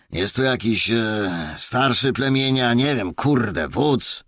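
gruff cop calling out loud. Acted. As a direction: shout to find the leader. Officer calls out to the Roma.

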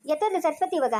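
ordinary delivery

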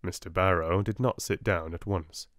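ordinary delivery

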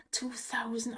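awed whisper. Real, not acted. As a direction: breathe it in a whisper. Whispering at slot win.

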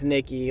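Nikki said as NEE-kee; Greek pronunciation. Shown as a demonstration. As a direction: say Nikki with an EE first vowel. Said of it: "it's really pronounced Nikki".

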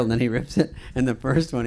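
normal talking voice

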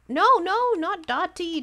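high, loud panicked no, no; alarmed shout. Real, not acted. Streamer yelping at the ugly villager on screen, high and loud. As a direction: yelp as the hated villager shows up. Villager she hates appears.

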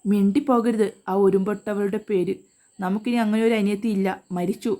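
ordinary delivery, nothing marked

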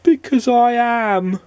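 high, slow and sulky; slow squeaky sulk; sad squeaky voice. A narrator's reading. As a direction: drag it out, stubbornly glum. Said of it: "A sad, squeaky sort of voice".

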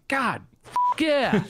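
high, blissful delight. Real, not acted. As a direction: savor it with pleasure. Savoring a drink.

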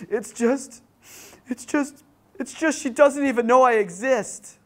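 hammed-up tearful outburst of a heartbroken boy; bawling, imitated. Crying boy confessing to his youth worker, mock bawling. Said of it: "and he's bawling".

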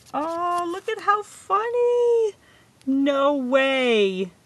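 high loud delighted squeal; excited squeal. Real, not acted. Funny coincidence gift, squealing.

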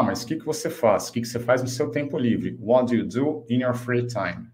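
usual speaking voice

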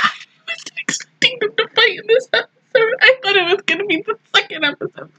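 high, choked-up squeak; tearful disbelief. Real, not acted. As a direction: squeeze it out through tears. Tearful over the unexpected fight.

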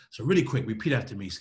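usual speaking voice

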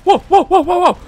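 high, loud, panicky protest; shocked disbelief. Real, not acted. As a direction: cry out in alarmed disbelief. Shocked by boss's new attack.

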